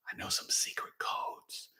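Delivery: secretive whisper. Real, not acted. Sharing a secret with viewers.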